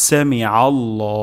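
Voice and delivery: সামি আল্লাহ slow, with a throat ain. Shown as a demonstration. Teacher modelling সামি আল্লাহ with ain. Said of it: "আইন উচ্চারণ করব গলার মাঝখান থেকে".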